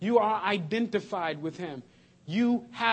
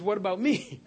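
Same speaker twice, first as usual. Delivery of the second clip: normal talking voice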